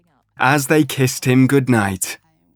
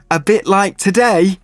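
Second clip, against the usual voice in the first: put-on child voice; 8 semitones higher than usual. A narrator's reading. Button, child character.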